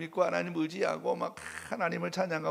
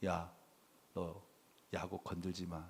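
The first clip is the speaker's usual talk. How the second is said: low, much softer stern threat; God warning Laban; 8 semitones lower, 11 dB quieter than usual. Pretend. God warns Laban in a dream, low soft threat. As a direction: hush it down into a warning. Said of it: "하나님이 나타나서 라반에게 뭐라고 말해요?".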